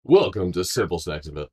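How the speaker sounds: announcer voice, loud and bright; radio voice. Shown as a demonstration. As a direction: open like a radio host. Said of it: "Maybe I need to work on my radio voice".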